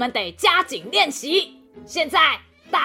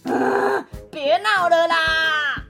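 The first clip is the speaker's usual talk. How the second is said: exasperated; 3 semitones higher, slower than usual. Acted. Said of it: "松鼠濒临崩溃".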